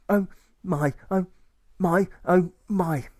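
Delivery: high, slow drawn-out oh my; despairing moan. Acted. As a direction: stretch each oh my in despair.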